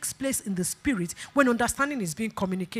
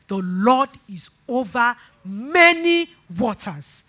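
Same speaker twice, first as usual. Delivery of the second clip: slow, stretched preaching phrase; slow pulpit declaration; emphatic proclamation; 4 dB louder, slower than usual. Real, not acted. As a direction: draw out each word with growing weight.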